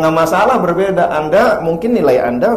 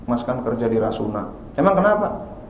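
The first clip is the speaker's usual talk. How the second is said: softer, lower coaxing wife; hint of a nagging wife, quiet; mild wife voice; 5 semitones lower, 7 dB quieter than usual. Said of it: "Istri berkata". (istri merayu pelan)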